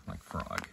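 frog with the open ah vowel, said low and soft; low demo of the ah in frog; ah sound. Shown as a demonstration. Teacher giving students frog as an example of ah. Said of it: "that's how you make the ah sound".

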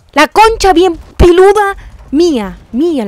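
slow, loud, high drawn-out curse; furious yell. Real, not acted. Grita al fallar un salto. (grito lento y furioso)

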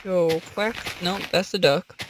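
usual speaking voice

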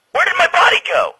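high, loud cry of disbelief; loud, frustrated yell, stretching the words at the end; exasperated yelling. Acted. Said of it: "Would you stop yelling?".

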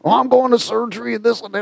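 high mocking whine of an excuse; mock grumbling. Mask wearer complaining about surgery, high mock whine. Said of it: "he was grumbling, complaining".

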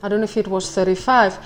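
ordinary delivery

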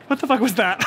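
laughing in disbelief. Real, not acted. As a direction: crack up while asking. Repeats it laughing.

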